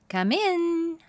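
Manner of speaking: high, loud call to the door; young owl calling a visitor in. A narrator's reading. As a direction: call out to the knocker. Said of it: "Spotty called out".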